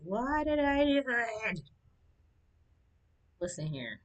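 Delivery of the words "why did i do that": surprised. Real, not acted. Sudden sour taste reaction.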